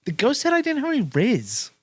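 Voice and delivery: very high, loud complaint; mock distraught whine. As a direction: wail it like a wounded sibling, hammed up. Plays sibling whining about the ghost.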